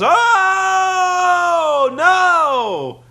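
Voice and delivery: high, loud drawn-out "Oh, no!"; room-wide shout. Retold or imitated. Whole backstage room reacting to the bottle, loud shout. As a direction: holler it like a room full of wrestlers. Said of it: "the entire room goes".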